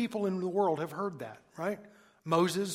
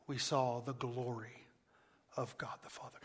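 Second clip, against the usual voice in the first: hushed; 5 semitones lower, 9 dB quieter than usual. Acted. Quiet awed climax of quoted verse.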